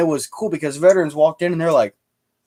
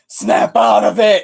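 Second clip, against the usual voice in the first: slow, higher, louder mock shout; 8 semitones higher, 4 dB louder, slower than usual. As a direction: shake him awake with a hammed-up yell. Shaking reenactor by shoulders.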